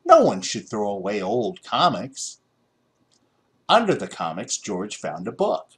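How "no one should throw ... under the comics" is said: plain reading voice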